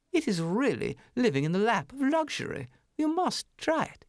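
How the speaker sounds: a prim, high city mouse picking at words; snooty city mouse. Acted. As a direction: turn your nose up at the farmyard.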